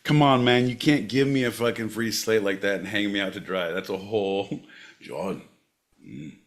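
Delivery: put-on low, soft old Scotsman in a Scottish accent; old Scotsman reminiscing. Plays old Scotsman recalling hard times.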